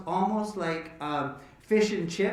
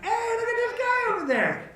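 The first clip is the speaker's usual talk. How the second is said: high excited hail across the street, much higher; calling out. Retold or imitated. Riding the open bus top, hailing a passerby, high excited call. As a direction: sing out to a stranger below.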